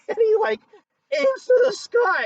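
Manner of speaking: high, loud, breaking into laughter; cracking up at the memory; laughing while telling. Real, not acted. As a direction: squeal it out through real laughter. Cracking up telling friend's wild shot.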